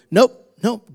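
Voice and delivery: loud, firm "no"; firm rebuke. Retold or imitated. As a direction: shut the devil down. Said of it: "you hit the devil with".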